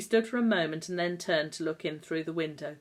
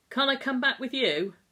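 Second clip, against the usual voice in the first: pleading; 8 semitones higher, 7 dB louder than usual. Acted. Sick boy begs to go home.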